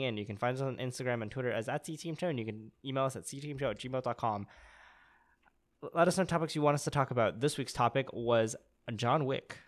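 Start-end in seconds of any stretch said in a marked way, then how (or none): none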